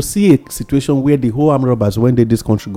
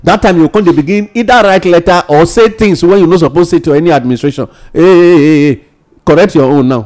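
raised, heated scolding voice; loud rant; 6 semitones higher, 6 dB louder than usual. Real, not acted. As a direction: call them out, nearly shouting. Radio rant against politicians.

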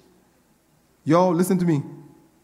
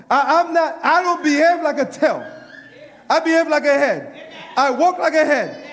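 excited preaching; 6 semitones higher than usual. Real, not acted. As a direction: build it with joy to the final shout. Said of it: "I'm getting excited".

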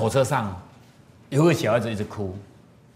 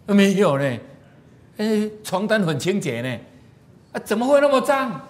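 mock surprise; 8 semitones higher, 4 dB louder than usual. Husband opens window, mock surprise.

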